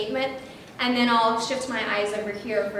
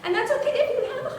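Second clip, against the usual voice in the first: much higher, light demo voice; speaking up high as an example; high pitch; 12 semitones higher than usual. Shown as a demonstration. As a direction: show what a high voice sounds like. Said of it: "really high pitch".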